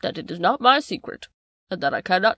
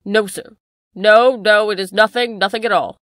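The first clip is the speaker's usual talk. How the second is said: loud, panicked interruption; frantic denial; 11 dB louder than usual. Acted. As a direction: cut in desperately. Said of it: "the butler broke in".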